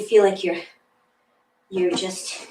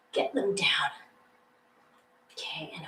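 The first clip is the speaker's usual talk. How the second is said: whisper; 6 semitones lower, 11 dB quieter than usual. Real, not acted. Instructor cueing a held plank.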